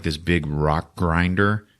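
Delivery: ordinary delivery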